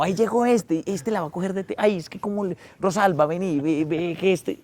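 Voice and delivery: high gossipy relative announcing the family joker. Retold or imitated. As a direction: play the excited family gossip calling Rosalba.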